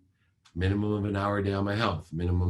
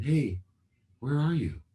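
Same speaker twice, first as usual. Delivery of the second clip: calm father; 7 semitones higher than usual. Retold or imitated. Father calmly calls daughter.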